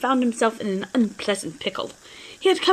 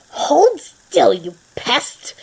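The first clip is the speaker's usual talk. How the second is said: angry shout; 3 semitones higher, 4 dB louder, much slower than usual. Acted. Grell chasing cat with broom.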